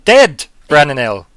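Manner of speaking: loud, slow incredulous check; stunned, drawn-out question; disbelieving. Acted. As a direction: hit it hard in disbelief. Character stunned by the news.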